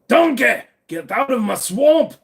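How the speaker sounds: put-on loud, high ogre bellow in a rough Scottish accent; Shrek. Streamer doing a cartoon ogre for his chat, ogre bellow. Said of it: "the Shrek one?".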